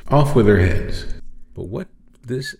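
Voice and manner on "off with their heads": loud, commanding "Off with their heads"; mock royal decree. Imagining Joseph sentencing his brothers, booming command. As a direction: bark it like a tyrant king.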